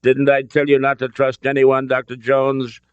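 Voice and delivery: smug reproach. A narrator's reading. Betrayer gloats over Doctor Jones, smug. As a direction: rub in the betrayal.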